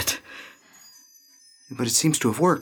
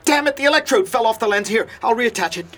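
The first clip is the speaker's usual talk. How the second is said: high, agitated muttering while fixing things; flustered and urgent; frantic; 5 semitones higher than usual. Acted. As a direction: scramble to fix it, voice raised. Frantic to restore lost picture.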